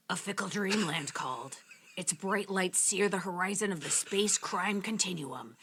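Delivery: low, gravelly noir narrator. Acted. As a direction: growl it out deep and rough like a hard-boiled narrator. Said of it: "was my gravelly voice not gravelly enough".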